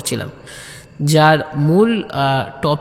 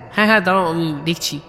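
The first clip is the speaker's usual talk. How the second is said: fast, rushed reassurance; hurried reply; much faster than usual. Acted. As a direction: rush in to check. Saheb hurrying to check. (তাড়াহুড়ো উত্তর)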